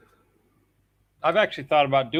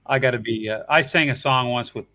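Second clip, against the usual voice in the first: ordinary talk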